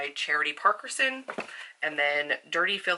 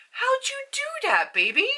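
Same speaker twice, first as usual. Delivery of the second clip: high pet voice. Real, not acted. Asking her cat how it jumped up, high baby-talk coo.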